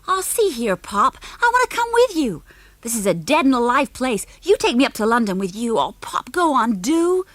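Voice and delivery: put-on high, pushy American kid's whine; spoiled American boy pestering Pop; whiny American boy. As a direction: wheedle like a spoiled boy begging his dad. Junior whining at his father.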